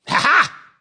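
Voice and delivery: sudden high, loud burst of ha ha; mocking laugh. Real, not acted. Mocking [name]'s argument to viewers, loud laughing ha ha.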